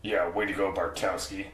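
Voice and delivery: quiet gruff line through teeth; a hint of Casey's growl; Casey impression through gritted teeth. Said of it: "that was pretty good".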